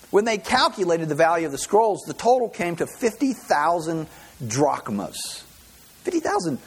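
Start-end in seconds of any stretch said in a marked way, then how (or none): 6.0-6.6 amazed emphasis. Real, not acted. Repeats sum in amazement.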